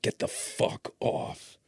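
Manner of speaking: slow, cold, barely voiced threat; menacing whisper. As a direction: stare him down and hiss it slowly. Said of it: "[name] Devil mode".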